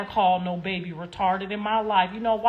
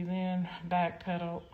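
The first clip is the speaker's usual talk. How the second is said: usual speaking voice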